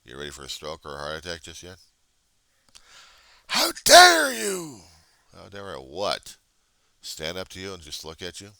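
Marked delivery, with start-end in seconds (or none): 3.5-4.8 very high, loud, slow indignant heckler voice; outraged heckler. Acted. Heckler outraged that he stands up to them, mock indignation. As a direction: gasp it like an outraged troll.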